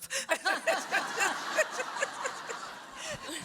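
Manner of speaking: laugh. Real, not acted. Laughing about getting mad.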